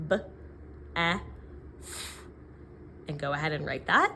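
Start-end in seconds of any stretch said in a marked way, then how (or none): 0.1-2.2 "B, E, F" sounded one by one; tapping out sounds. Shown as a demonstration. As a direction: tap out "B, E, F" sound by sound. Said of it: "Let's tap that out".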